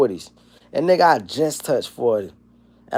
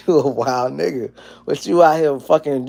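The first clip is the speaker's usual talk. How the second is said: ordinary delivery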